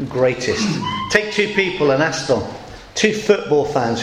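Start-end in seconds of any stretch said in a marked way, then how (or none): 0.1-0.7 Greatest set apart and weighed; Greatest said alone. Shown as a demonstration. Preacher dwelling on the word Greatest. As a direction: let Greatest stand alone after a pause. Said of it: "that word greatest".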